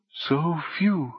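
soft, high, mournful voice; gentle high-pitched sorrow; sad. A narrator's reading. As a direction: say it sadly, light and hushed. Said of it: "it said sadly".

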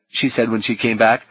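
plain reading voice

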